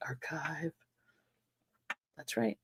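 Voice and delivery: soft unvoiced whisper. Real, not acted. Host whispering the new word to co-host, hushed whisper. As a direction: breathe it like a secret.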